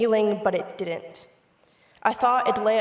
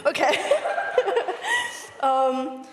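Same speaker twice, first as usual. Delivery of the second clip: high, teary nervous laughter; 7 semitones higher, slower than usual. Real, not acted. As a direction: laugh off the tears. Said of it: "I'm trying not to cry".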